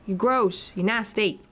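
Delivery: usual speaking voice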